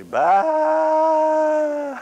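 a huge high sheep's "Bah!" bleated as loud as possible; sheep bleat shout. Pretend. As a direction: bleat like a stranded sheep, full out. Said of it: "As loud as you could".

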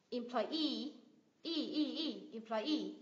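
"Employee" as em-ploy-EE, the EE drawn out; stress on final syllable. Shown as a demonstration. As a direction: isolate and stretch the EE of "employee". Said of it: "nhấn vào ngay cái âm tiết đấy".

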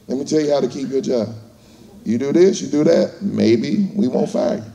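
low, quick, quiet boss voice; boss laying down rules. Acted. Boss telling a new hire how to keep his job, low and quick. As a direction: drop low and rattle off the bosses' terms. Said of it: "Hey, they said".

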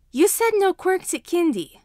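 put-on indignant child; arguing child. A narrator's reading. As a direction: whine it as a defiant excuse. Child continuing his argument.

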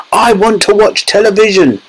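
put-on high, tiny boy's whine; squeaking tantrum. A narrator's reading. Shrunken boy demands television from parents, squeaky tantrum. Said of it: "he squeaked".

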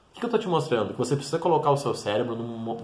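ordinary delivery

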